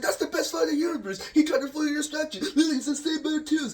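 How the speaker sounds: very high, loud, excited bystander voice; cartoonish high hype voice; hyped onlooker. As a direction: squeal it like a thrilled fan. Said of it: "Lisbon Cop's like".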